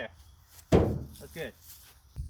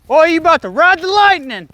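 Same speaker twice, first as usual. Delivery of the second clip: very loud, higher yelled commands; shouting during chase; 7 semitones higher, 16 dB louder, slower than usual. Acted. Cop yelling taser warnings at the fleeing suspect, very loud. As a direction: yell it mid-chase, all out.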